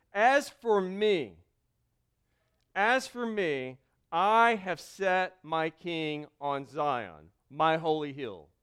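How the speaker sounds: slow, weighty, each phrase spaced out; slow solemn reading. Acted. Pastor reading God's decree to the congregation, slow and solemn. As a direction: pronounce it like a royal decree.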